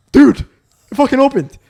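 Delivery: loud, high retold disbelief; re-enacted shock, punched out loud; stunned shock. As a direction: announce it like an impossible miracle. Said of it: "I'm in such shock".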